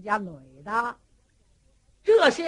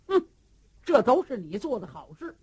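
plain reading voice

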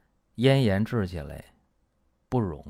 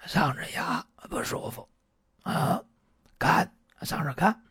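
whispered, strained patient voice; hoarse patient; 8 semitones higher than usual. Retold or imitated. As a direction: mimic a patient who has lost his voice.

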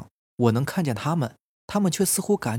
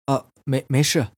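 flustered, stumbling brush-off; hurried reply. A narrator's reading. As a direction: stammer out a hasty reassurance. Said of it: "于是赶紧说".

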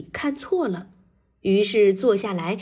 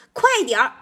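urging; 3 semitones higher, 3 dB louder, much faster than usual. A narrator's reading. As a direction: hurry them along. Skunk urges friends to follow. (急切地催促)

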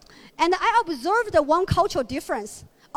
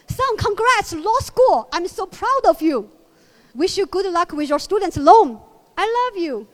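breezy American dad; 3 semitones higher, 6 dB louder than usual. Acted. Father congratulates his son on law school, mock chipper. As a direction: cheer him off, then dump the loan on him. Mimics American parent's send-off.

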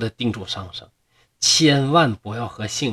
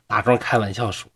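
ordinary delivery, nothing marked

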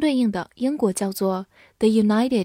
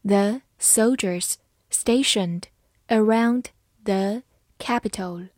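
slow, careful phrasing; slow reading; slower than usual. Shown as a demonstration. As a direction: read it slowly for learners. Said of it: "好，慢慢来读。". (慢速朗读)

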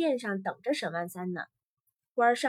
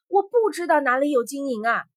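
loud protesting shout; 3 semitones higher, 7 dB louder than usual. A narrator's reading. Beaten prisoner answering the warriors, shouting. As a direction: cry out in desperate denial. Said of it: "沈万三喊着说".

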